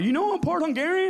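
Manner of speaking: high, bright mock party brag; party bore. Guest at a party boasting of ancestry, high mock brag. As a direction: mimic the smug guest sharing ancestry.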